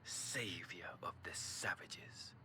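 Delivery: low, slow whispered threat; menacing whisper. Acted. Departing envoy whispers a threat at the Warrior of Light, menacing whisper. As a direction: hiss a promise of revenge as you leave. Villain's parting threat, under breath.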